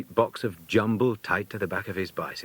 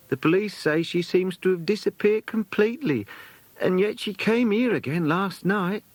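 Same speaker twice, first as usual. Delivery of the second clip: lightly voiced fussy lady companion, higher; a hint of a bustling old companion at the door; chatty companion; 12 semitones higher than usual. Companion speaks, voice shifted.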